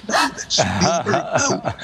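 high, slow, frantic warning; panicked crew member. Retold or imitated. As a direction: urgently stop him before he speaks. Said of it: "the whole of the production crew was going".

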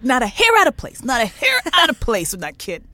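mock shocked retelling; scandalized gossip. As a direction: gasp it like juicy gossip. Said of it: "She was like".

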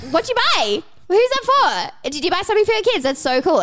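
very high, excitable mock prying; nosy excitement. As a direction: pounce on the gift with squealed questions. Acts out herself being nosy.

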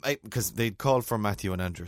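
ordinary delivery

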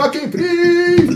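very high, drawn-out re-enacted yell; freaking out. Freed prisoner at the window to the yard, ecstatic high yell. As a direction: stretch out a wild cry of triumph. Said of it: "Och då freakar jag loss och bara".

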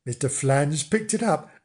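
plain reading voice

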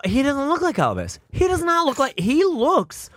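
rising, high-pitched disbelief; exasperated. Real, not acted. Heated denial, pitch climbing.

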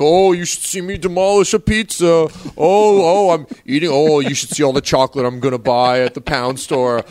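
hammed-up Nick gloating over pizza and chocolate; Nick impression. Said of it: "doing the full impression".

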